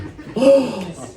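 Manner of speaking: big mock gasp of wonder; awestruck gasp. Patient in bed sees the nurse gliding down the ward, mock awestruck gasp. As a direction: gasp as if seeing a vision.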